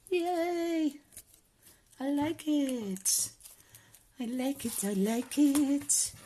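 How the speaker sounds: high, slow, cooing delight at the finished card. Real, not acted. Crafter delights in her finished card, high and slow. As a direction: squeal with pleasure over your work.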